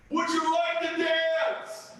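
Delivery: slow, loud and drawn out; loud slow ask. Acted. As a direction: belt the question slowly. Bear asks woman to dance.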